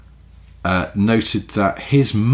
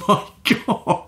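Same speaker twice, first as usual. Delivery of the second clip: laughing; 13 semitones higher than usual. Real, not acted. Laughing disbelief.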